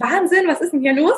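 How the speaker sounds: mock amazement, rising at the end; amazed clients. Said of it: "dass meine Klienten immer sagen".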